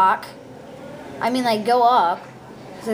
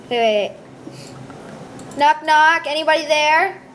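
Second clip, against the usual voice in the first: normal talking voice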